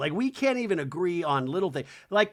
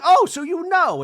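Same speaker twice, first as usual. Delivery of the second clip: loud, high-pitched challenge; mock confrontation; 13 semitones higher, 11 dB louder than usual. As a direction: pounce on it, imitating an accuser. Acting Bronwyn confronting Brittany.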